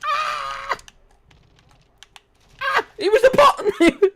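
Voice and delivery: high, loud shriek into laughter; startled laughing yell. Real, not acted. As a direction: shriek in surprise, then laugh.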